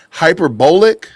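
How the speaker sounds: HYPERBOLIC with "bowl" as its stressed middle; affected mispronunciation. Retold or imitated. Host mimicking how Candace says a word, Hyperbolic as hyper-BOWL-ic. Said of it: "tries to pronounce words".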